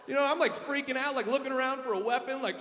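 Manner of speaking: ordinary delivery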